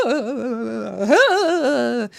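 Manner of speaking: hammed-up mourner's howl; mock crying wail. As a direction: bawl theatrically in two waves. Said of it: "She's on her knees crying".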